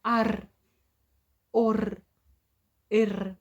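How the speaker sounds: Ар, ор, ир ending on a rolled р; vowel then r. Shown as a demonstration. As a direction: open the vowel, then roll the р. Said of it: "скажемо перший звук А". (Ар, ор, ир з розкотистим р)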